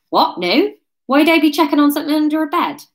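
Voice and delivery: fast, high Wurzel protest; hurried West Country bluster; West Country denial. Acted. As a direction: deny it indignantly in West Country. Ryan denies it, West Country voice.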